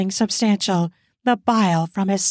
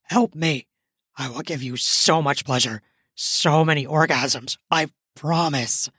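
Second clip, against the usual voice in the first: low pleading; 6 semitones lower than usual. Acted. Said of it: "he pleaded".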